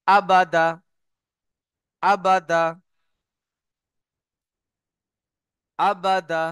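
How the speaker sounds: আবাদা crisp, no vowel drawn out; unstretched short vowels. Shown as a demonstration. Teacher modelling harakat for students, short unstretched আবাদা. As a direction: say আবাদা with three short, even a's. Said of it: "টান না দিয়ে তাড়াতাড়ি পড়তে হয়".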